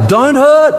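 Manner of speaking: preacher driving the point; emphatic plea. Acted. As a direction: press it home. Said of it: "That's what God's saying today".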